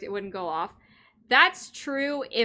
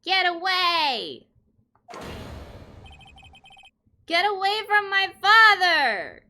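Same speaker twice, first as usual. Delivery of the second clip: desperate scream; 8 semitones higher, 7 dB louder than usual. Acted. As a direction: scream to drive an attacker off. Acted scream at father's attacker.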